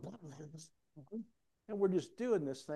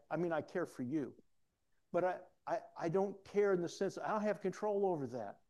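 ordinary talk, nothing marked

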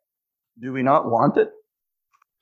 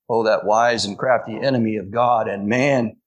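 ordinary talk

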